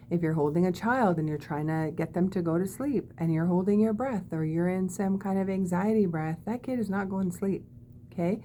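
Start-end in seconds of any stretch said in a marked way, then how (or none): none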